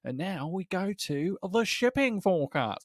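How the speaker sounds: slow, high excited radio announcer; Jim Maxwell. Retold or imitated. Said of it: "he's taken to kind of leading the radio broadcast".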